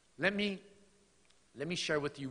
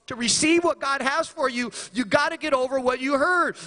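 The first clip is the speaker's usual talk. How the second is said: restated with a higher, louder push; preached, high and loud; 13 semitones higher, 9 dB louder than usual. Shown as a demonstration. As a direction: repeat it as a rousing call.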